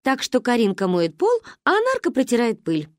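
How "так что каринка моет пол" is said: ordinary delivery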